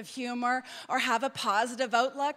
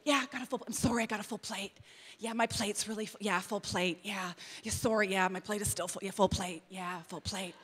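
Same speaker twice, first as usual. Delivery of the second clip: weary excuse voice; 6 semitones lower, 6 dB quieter than usual. Retold or imitated. Mimicking overbooked excuse-maker.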